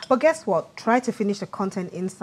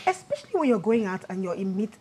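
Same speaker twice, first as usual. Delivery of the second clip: usual speaking voice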